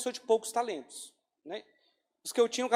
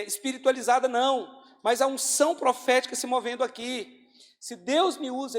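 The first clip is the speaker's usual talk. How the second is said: loud, driving sermon voice; fervent preaching; 3 semitones higher, 6 dB louder than usual. Acted. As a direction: preach it with full conviction. Pregação em oração fervorosa. (pregação fervorosa e alta)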